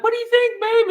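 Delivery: teasing falsetto; high mock voice.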